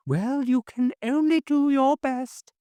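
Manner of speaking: put-on a kindly old widow soothing her friend; sweet old lady. A narrator's reading. Mrs Hines reassuring her friend, high and sweet.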